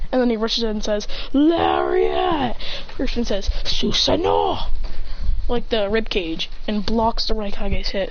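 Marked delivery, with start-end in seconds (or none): 1.3-2.6 higher-pitched attack call; mock anime attack shout; battle cry. Attack move called mid-fight. 3.7-4.7 drawn-out, higher-pitched attack call; slow mock battle cry; summoning call. Summoning technique called in fight.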